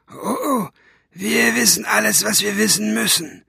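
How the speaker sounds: high, rough villain's voice; rough cat-tongue voice. A narrator's reading. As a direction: rasp it like a cat's tongue. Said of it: "Das war wieder die andere Stimme". (raue, hohe Katzenzungenstimme)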